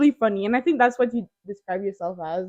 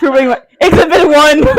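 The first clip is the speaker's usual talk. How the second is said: higher, louder words through laughter; laughing; 5 semitones higher, 12 dB louder than usual. Real, not acted. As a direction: crack up while announcing.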